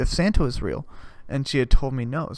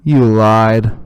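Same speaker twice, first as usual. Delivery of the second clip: loud, slow, dragged-out accusation; betrayed accusation; 5 semitones lower, 8 dB louder, much slower than usual. Retold or imitated. Eleven-year-old accusing mom of lying, loud and slow. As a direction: hurl the betrayal at her slowly. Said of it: "I look at her and I go".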